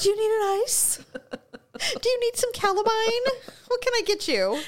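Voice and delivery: high, sweet fussing offers; fussing caretaker. Pretend. Friend showing how she would fuss over the bitten woman. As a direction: dote on her like a nurse. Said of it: "I'm like".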